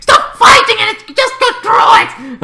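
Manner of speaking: high, loud pleading yell; hammed-up high scream; mock desperate shout. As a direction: shriek it desperately.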